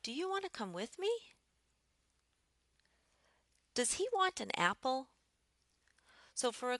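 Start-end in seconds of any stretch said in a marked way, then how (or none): 0.0-1.3 "Do you want to come with me" climbing high to "me"; rising yes-no question. Shown as a demonstration. Looking for a yes or no answer, rising intonation. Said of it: "we would use a rising intonation with a yes-no question". 3.7-5.1 "Does he want an apple" high, lifting on "apple"; rising yes-no question. Shown as a demonstration. Looking for a yes or no answer, rising intonation. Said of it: "with a higher tone than I started with".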